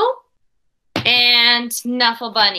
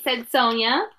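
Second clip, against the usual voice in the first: put-on expressive read-aloud for children; storybook reading voice; story narration; 2 semitones higher, slower than usual. A narrator's reading. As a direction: read the picture book to the class with care. Teacher reads picture book aloud.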